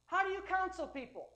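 a hint of a commanding divine voice; voice of the Holy Ghost. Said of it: "authoritative voice in my spirit".

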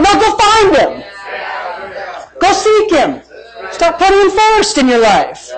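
high, emphatic preacher's call; fired-up preaching command; forceful exhortation. Real, not acted. Exhorts congregation after mock plea.

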